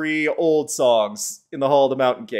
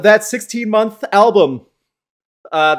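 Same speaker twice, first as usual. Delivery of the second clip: ordinary talk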